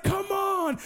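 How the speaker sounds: high-pitched celebrating exclamation; excited cheer. Retold or imitated. Young evangelist celebrates his first convert, thrilled.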